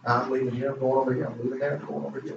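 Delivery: soft, offhand imitation of church-hoppers; quiet mimicry. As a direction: drop the volume for a murmured aside quote. Voices church-hoppers moving between churches.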